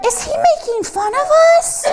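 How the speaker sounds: high, fast, offended frog voice; indignant frog. Acted. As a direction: rattle it off as a huffy frog. Frogs voiced in story.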